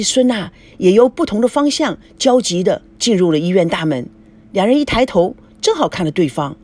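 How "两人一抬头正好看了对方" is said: ordinary delivery, nothing marked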